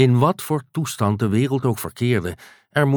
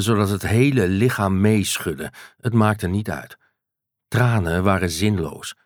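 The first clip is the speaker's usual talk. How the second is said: ordinary delivery, nothing marked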